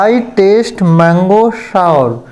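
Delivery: very slow, careful model sentence; slow correct example. Shown as a demonstration. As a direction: spell it out slowly for learners. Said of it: "यहाँ पर आपका है". (धीरे-धीरे सही वाक्य)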